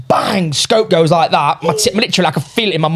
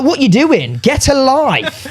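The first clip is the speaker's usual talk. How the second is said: higher-pitched, incredulous retelling, last words drawn out; exasperated mock retort; 7 semitones higher, much slower than usual. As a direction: scoff at them, stretching the final words. Mocks people making soppy edits.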